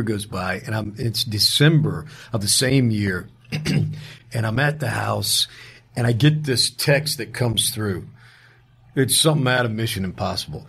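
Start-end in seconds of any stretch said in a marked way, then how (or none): none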